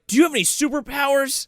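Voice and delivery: high, eager impression; Tim Robbins. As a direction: ask it in giddy mock excitement. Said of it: "So Tim Robbins is like".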